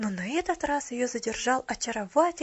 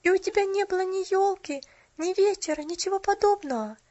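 put-on high, louder childlike dismay; shocked, upset; 9 semitones higher, 4 dB louder than usual. A narrator's reading. Pollyanna hearing the girl had no tree, shocked and upset. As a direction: exclaim in astonished distress. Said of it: "поражённая и расстроенная".

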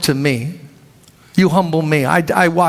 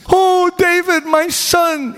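very high, sweet put-on voice; Saul's gushing reply; 11 semitones higher, slower than usual. Saul gushes fondly to David across the camp. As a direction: gush like a fickle king.

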